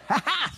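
small high "ha ha"; soft mock laugh. Kids mocking the lady in the back. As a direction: quietly echo the lady. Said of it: "she's like".

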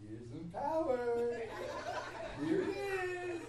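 laughing through a teasing taunt; hammed-up taunt broken by laughter; mock teasing God. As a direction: play a God who dangles power and snatches it back. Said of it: "He's like".

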